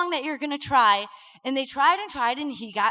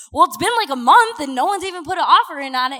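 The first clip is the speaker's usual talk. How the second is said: louder, fast, huffy complaint; frustrated; 2 semitones higher, 8 dB louder, faster than usual. Retold or imitated. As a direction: snap it out, annoyed the house won't sell. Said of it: "talking like this 'cause that's how I get when I get so mad".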